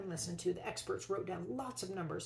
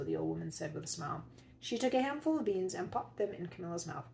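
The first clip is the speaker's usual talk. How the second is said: plain reading voice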